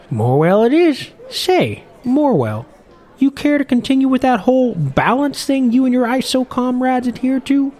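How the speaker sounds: put-on old fisherman. Old fisherman offers a job.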